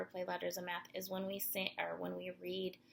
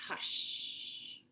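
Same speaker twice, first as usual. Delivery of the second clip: plain reading voice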